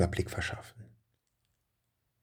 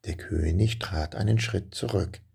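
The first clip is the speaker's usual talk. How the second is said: plain reading voice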